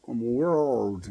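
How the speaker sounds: world with a curled American R; world, careful American R; pronunciation of world. Shown as a demonstration. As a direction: say world with the R rolled back into the vowel. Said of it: "o R é realmente pronunciado como girl".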